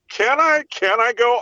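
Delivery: high, nervous disbelief; panicky high voice. Retold or imitated. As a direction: squeak it out, too scared to believe it. Voicing the panicked little guy.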